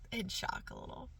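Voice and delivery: voiceless near-whisper; hushed. Real, not acted. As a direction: let it sink away into a whisper. Admits lingering shock in a hush.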